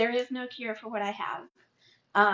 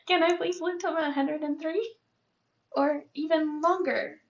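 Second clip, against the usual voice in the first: crying, higher and strained; tearful plea; 5 semitones higher than usual. Real, not acted. Patient asking God to live till a hundred, crying. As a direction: plead through real tears.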